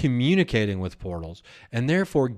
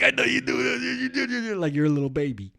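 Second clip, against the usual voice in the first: put-on very high squeaky baby talk; tickle-talk in a high, gleeful squeal; tickling a baby; 14 semitones higher, faster than usual. Host mock-tickling listener like a baby, high squealing baby talk. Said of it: "kinda tickling you".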